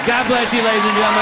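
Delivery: ordinary delivery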